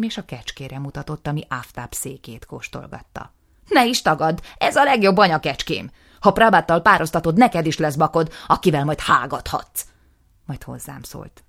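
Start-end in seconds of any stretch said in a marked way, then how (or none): 3.7-9.8 loud, high, escalating tirade; furious rant. Acted. Nisim raging at his brother, loud and high. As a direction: build to a furious yell.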